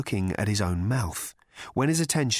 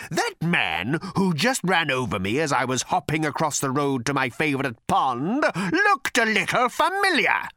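rising aggrieved voice; 6 semitones higher, 3 dB louder than usual. Acted. Quoting its own spirit.

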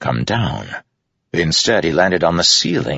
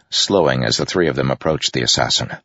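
ordinary delivery

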